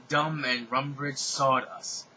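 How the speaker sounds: usual speaking voice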